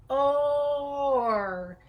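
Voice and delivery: "or" started on a held O before the R; O first, then R. Shown as a demonstration. Therapist cueing a child to start with the O. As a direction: open on the O of "or", then add the R. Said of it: "start with the O".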